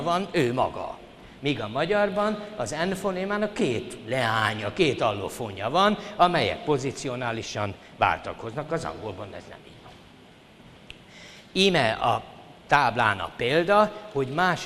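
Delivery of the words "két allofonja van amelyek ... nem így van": ordinary talk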